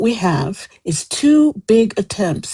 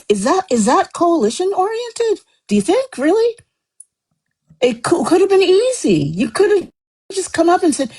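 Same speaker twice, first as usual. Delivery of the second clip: heated, pitch climbing higher; rising real indignation; getting angry; 9 semitones higher than usual. Real, not acted. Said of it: "I'm sorry, I'm getting angry".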